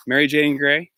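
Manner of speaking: ordinary talk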